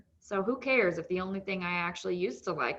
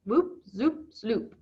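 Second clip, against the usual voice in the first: savoring the sound; 2 semitones higher, 5 dB louder, much slower than usual. Shown as a demonstration. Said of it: "I just love the way that sounds".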